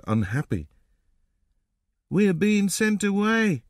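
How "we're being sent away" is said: put-on high, slow little boy's voice; small child explaining, pitched up; slow, high-pitched young boy. A narrator's reading. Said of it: "said the little boy".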